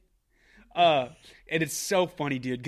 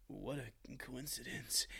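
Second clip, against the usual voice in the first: soft, slow, knowing mumble; a hint of a creepy flirt; mock sly murmur; 14 dB quieter, much slower than usual. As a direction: play it coy and slow. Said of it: "he goes".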